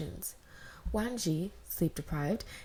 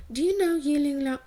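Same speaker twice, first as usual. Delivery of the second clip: put-on excited girl; 8 semitones higher, 8 dB louder than usual. Sisters' excited question.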